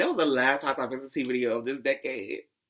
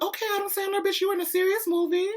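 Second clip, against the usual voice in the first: excited high voice. Retold or imitated. Gushing about the Uncut Gems trailer, excited high squeal. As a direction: voice leaps way up in excitement. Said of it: "You see how my voice went up".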